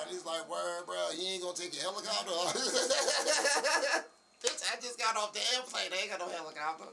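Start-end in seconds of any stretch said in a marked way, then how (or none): 0.5-2.3 high, loud mock disbelief; incredulous friend. Retells friend's incredulous question. 2.4-4.1 loud, high cackling laugh; real burst of laughter. Real, not acted. Cracks up at own story. 4.4-6.9 high, loud mock outrage; indignant comeback. Retells own indignant comeback.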